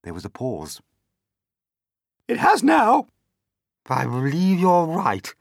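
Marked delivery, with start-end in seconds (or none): 2.3-3.1 high, loud exclamation; excited announcement. A narrator's reading. Aunt exclaims after the pause. 3.9-5.3 high, loud reply; excited agreement. A narrator's reading. Other aunt agrees excitedly.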